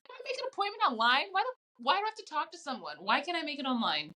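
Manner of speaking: high, quick pleading whine; whiny complaint. Retold or imitated. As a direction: whine it out in a rush. Mimics own complaint about phoning.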